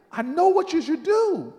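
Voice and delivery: loud, high proclamation; emphatic repeat. Retold or imitated. Miss Jones stringing him along, emphatic. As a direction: proclaim it with total certainty. Elder repeats claim, teasing out suspense.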